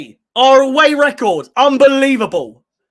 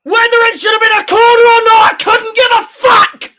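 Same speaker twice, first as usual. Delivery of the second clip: high-pitched, full-throated celebration yell; ecstatic shout; 10 semitones higher, 4 dB louder than usual. Real, not acted. Fan celebrating the comeback goal, ecstatic shouting. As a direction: keep screaming in sheer triumph.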